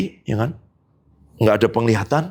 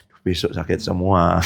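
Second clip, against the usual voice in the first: weary dread; 9 semitones lower than usual. Retold or imitated. Dreading the pain before praying.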